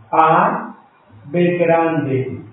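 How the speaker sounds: ordinary talk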